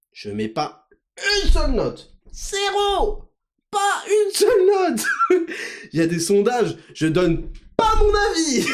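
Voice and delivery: high, slow mock listener voice; high hammed-up smug listener; smug listener impression. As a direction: ham up a fan who never rates. Impersonating non-rating listener.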